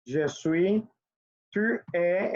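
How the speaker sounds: ordinary delivery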